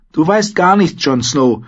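hoch und neckend: „Du weißt gar nichts, Jon Snow“; „weißt“ betont, frech und deutlich höher; neckende Ygritte. Acted. (Ygritte, neckend und höher)

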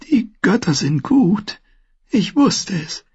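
high, slow, failing breath; weak, dying voice. A narrator's reading. Wounded maester relieved to see the boys, frail and slow. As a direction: speak as if badly wounded.

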